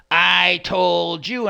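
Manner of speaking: drawn-out, loud I-told-you-so; mimicked nagging roommate, long-drawn words; mock scolding. Quoting angry roommate catching them.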